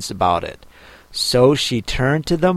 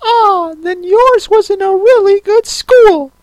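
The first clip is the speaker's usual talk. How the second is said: loud, very high sigh of relief; greatly relieved; 8 dB louder than usual. A narrator's reading. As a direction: let relief pour out in a squeaky turtle voice. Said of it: "in a tone of great relief".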